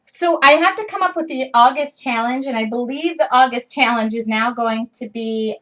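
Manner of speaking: ordinary talk, nothing marked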